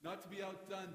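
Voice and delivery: ordinary talk, nothing marked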